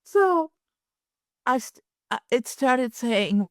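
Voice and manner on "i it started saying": usual speaking voice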